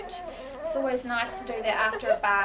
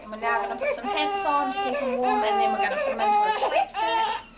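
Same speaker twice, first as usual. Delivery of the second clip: raised voice over crying; 12 semitones higher, 5 dB louder than usual. Real, not acted. Talking over crying baby.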